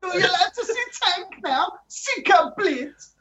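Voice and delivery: high put-on Eastern European accent; Macedonian accent. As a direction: play a fake-news writer in a hammy Macedonian accent. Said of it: "Do an impression".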